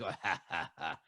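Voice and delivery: soft, low mock chuckle; a hint of Frank laughing at the new guy; imitating a knowing laugh. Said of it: "He starts laughing".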